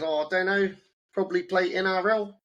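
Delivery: lightly voicing a laid-back teenage student; student's voice. Teacher voices student's reply.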